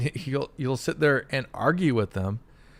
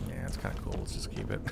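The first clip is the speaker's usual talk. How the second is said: low, soft mock shrug; offhand developers; 10 dB quieter, faster than usual. Developers deciding on a placeholder, quietly offhand. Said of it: "they're like".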